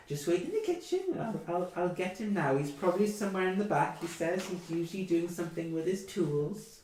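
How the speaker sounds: put-on high, kindly old mother's voice; a hint of a chatty elderly mother; old woman. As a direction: fuss over the guests like a doting granny. Old woman sends them to kitchen.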